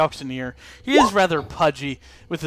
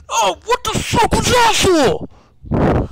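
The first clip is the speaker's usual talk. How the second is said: high, loud, slow pained yelp; pained auctioneer yelp; 9 dB louder, slower than usual. Acted. GM as the punched auctioneer, a high loud yelp. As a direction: yelp it out like a man just punched in the nose. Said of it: "He goes".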